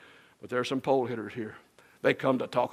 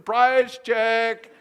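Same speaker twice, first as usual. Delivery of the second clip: higher, louder call with a laugh; loud cashier call; 9 semitones higher, 9 dB louder than usual. Retold or imitated. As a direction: call out for the whole store. Cashier calls out.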